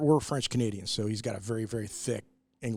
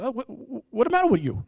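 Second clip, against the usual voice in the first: put-on much higher, louder, thick French Canadian accent; teasing French Canadian accent, pitched way up; mock French Canadian mom; 13 semitones higher, 8 dB louder than usual. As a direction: play his French Canadian mother, high and exaggerated. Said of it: "with her big French accent, she goes".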